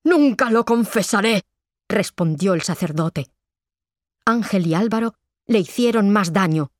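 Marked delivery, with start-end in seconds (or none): 0.0-1.4 high, pained refusal; defiant cry. A narrator's reading. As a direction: cry out in defiance, pitch raised. Tortured priest refuses to confess.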